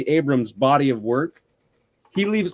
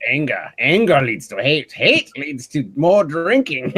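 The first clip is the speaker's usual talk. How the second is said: Yoda parody. Pretend.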